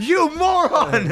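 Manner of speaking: high, slow mock rage; shaking the kid while yelling at him; mock furious yell. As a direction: snap at him in fake fury. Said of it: "shook the kid and went".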